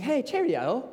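high-pitched, chirpy mock British greeting; mock British accent. Friend back from England greeting the speaker, mock British accent. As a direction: put on a high English lilt. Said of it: "I had a friend come back from England".

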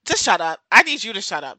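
ordinary talk, nothing marked

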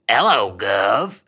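slow, put-on British greeting; lightly hammed-up British "'ello, guv"; mock British accent. Dinosaur imagined as British, greeting in mock British accent. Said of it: "What if they sound British".

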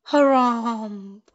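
stretched-out headmasterly harumph; elephant harumph. A narrator's reading.

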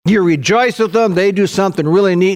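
usual speaking voice

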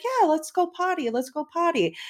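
mimicking her son cheering to go potty; excited toddler voice. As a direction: bounce like a thrilled toddler. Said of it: "He's like".

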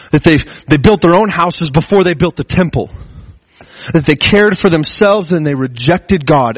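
impassioned preaching. Acted. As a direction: preach it with mounting urgency. Preaching heightened passage.

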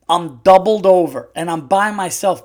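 ordinary delivery

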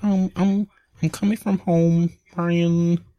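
high sing-song call of a name; Carly's voice. Retold or imitated. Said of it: "this is my Carly's voice".

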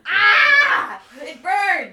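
high, loud hammed-up yelp; mock burning shout. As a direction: overact a sudden burn. Vocal warm-up silliness.

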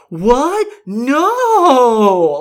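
high, loud, drawn-out mock disbelief. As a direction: overdo the shocked question, then wail the denial.

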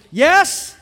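high, very loud affirmation; booming, jubilant-sized yes, pause between; emphatic shouted yes. Acted. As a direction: shout each yes as a resounding answer. Voices the Father's emphatic answer.

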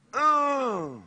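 loud, high straining roar; imitated monster transformation groan; Hulk. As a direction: strain out a wordless rage noise. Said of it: "do y'all know the Hulk".

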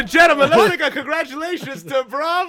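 high, loud showman's proclamation; mock announcer. Player celebrating a roll of ten, loud mock announcer. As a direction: hail the winner like a ringmaster.

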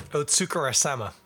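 "Otsukaresama" said smoothly in one go, oh-tsu-ka-re-sa-ma, falling at the end; got it right. Shown as a demonstration. After two misses, nails the Japanese word. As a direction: finally land "Otsukaresama" cleanly. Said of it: "There it is".

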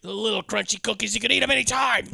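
mock put-on voice. Friend quoting a movie line in a goofy voice. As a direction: toss it off in a goofy voice.

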